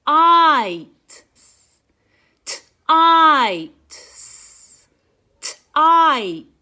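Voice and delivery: Tights. said loud and slow with a long I; stretched vowel. Shown as a demonstration. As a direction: lean hard on the I in Tights. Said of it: "Did you hear the I sound in the middle?".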